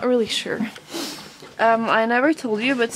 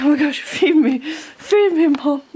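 voicing the horse; 3 semitones higher, 3 dB louder than usual. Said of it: "He's like".

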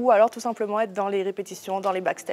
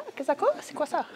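puzzled reaction; 7 semitones higher than usual. Retold or imitated. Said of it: "on me regardait, fait quoi?".

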